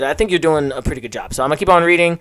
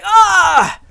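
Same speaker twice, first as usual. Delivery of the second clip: pained yell; 5 dB louder than usual. Acted. Character gets hit.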